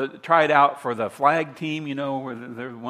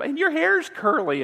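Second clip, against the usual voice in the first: high, singsong taunt an octave up; mocking little boy; 12 semitones higher than usual. Preacher imitating a playground boy teasing a girl, high mocking taunt. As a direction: tease her like a bratty playground kid. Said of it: "the little boy will say to the little girl".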